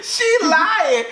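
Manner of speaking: high, laughing through the words. Real, not acted. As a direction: giggle out the accusation. Still laughing.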